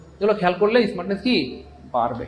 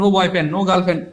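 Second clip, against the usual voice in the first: ordinary talk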